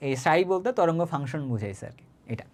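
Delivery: usual speaking voice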